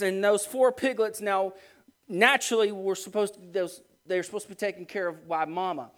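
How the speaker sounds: ordinary delivery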